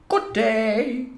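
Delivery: high-pitched, smiling greeting; smiling neighbour lady. A narrator's reading. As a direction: greet passers-by in a high lady's voice. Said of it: "smilede og sagde".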